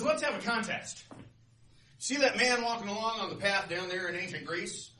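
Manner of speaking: high, loud challenge; blustery North Wind. Acted. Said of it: "he's really blustery".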